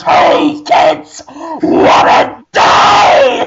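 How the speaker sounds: booming monster shout, climbing high on die; menacing shout. Acted. Giant CGI eye shouting a threat at the kids. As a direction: roar it at the crowd like a giant eye.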